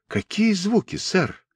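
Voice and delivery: put-on high, soft, courteous boy's question; polite question. A narrator's reading. Julian feigning ignorance to Mr. Lenoir, polite. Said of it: "вежливо спросил Джулиан".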